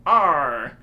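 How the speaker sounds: loud hammed-up pirate growl; Arr, yar, like a stage pirate; pirate talk. Player hams up pirate speak to the Viking. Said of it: "Why are you talking like that?".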